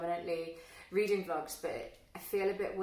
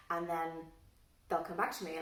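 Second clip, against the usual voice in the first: usual speaking voice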